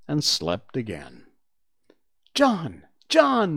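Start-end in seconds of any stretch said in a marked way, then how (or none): 2.3-3.5 high, loud calling of a name; insistent child's call, climbing higher; crying out. Acted. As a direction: call urgently to wake a sleeper. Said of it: "Michael would cry".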